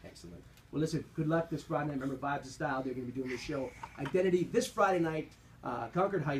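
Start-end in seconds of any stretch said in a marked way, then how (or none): none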